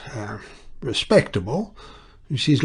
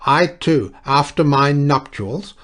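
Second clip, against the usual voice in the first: loud, high declaiming, slowing; thundering; 4 semitones higher, 7 dB louder than usual. A narrator's reading. Clergyman thunders his story at the breakfast guests. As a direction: thunder it out like a sermon. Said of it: "Mister Duchemin thundered on".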